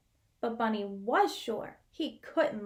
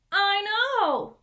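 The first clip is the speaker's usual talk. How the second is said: higher, louder agreeing; proud Bunny; 7 semitones higher, 6 dB louder than usual. Acted. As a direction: say it with proud glee. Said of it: "said Bunny".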